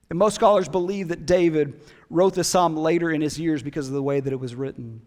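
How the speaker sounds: ordinary delivery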